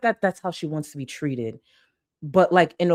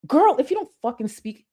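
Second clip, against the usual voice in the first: exasperated outburst; 7 semitones higher than usual. Real, not acted. Ranting at the streamer on screen, exasperated. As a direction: let the irritation burst out.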